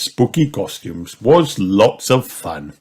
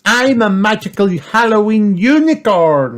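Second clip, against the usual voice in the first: loud, high-pitched proud announcement; Peppa; 14 semitones higher, 6 dB louder than usual. A narrator's reading. Peppa revealing her unicorn costume, high loud voice. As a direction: announce it big in a little-pig voice. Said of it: "said Peppa".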